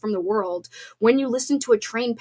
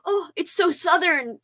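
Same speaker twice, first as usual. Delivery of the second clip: slower, higher, drawled exclamation of "so Southern"; mock gushing; 7 semitones higher than usual. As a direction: imitate your own delight at it. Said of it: "I was like".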